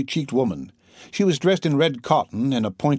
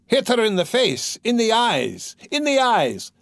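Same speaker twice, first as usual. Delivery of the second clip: much higher, frenzied yell; savage cry; 10 semitones higher than usual. Acted. Drunk Mikolka urging lads to beat the mare, frenzied high yell. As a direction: urge them on in a cruel frenzy. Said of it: "cried Mikolka".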